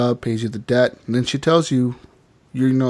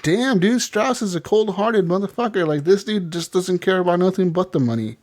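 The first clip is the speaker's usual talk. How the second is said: higher incredulous voice; shocked aside; 7 semitones higher than usual. Retold or imitated. Player reacting to Strauss, higher incredulous voice. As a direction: marvel at his coldness. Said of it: "I was like".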